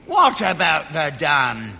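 slow, drawn-out retort; scornful echo. Acted. Old soldier dismissing the Frenchman's battle, slow scornful echo. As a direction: throw the question back slowly, with scorn. Throws Frenchman's question back.

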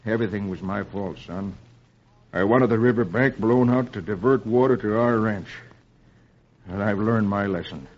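low, subdued father's voice; remorseful confession. A narrator's reading. Father confessing his fault to son.

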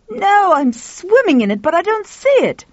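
high, loud, frustrated retort; exasperated. A narrator's reading. Frustrated man upstairs answering the woman, high exasperated outburst.

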